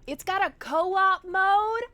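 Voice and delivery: high, loud, drawn-out surprised question; excited surprise. Real, not acted. Streamer discovers a game feature, loud excited surprise. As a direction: blurt it out in rising, stretched amazement.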